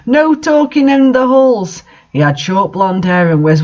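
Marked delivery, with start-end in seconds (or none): 0.0-1.8 higher, louder, slow scolding; hammed-up stern reprimand; angry headmaster. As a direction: bark down at the students. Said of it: "at us angrily".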